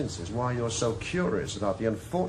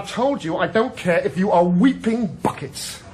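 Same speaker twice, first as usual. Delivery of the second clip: louder, scornful throwback; angry rebuttal; 7 semitones higher, 7 dB louder than usual. Acted. Inspector mocking Morgan's worry, loud scornful anger. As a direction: slap his words right back at him. Heated argument between detectives.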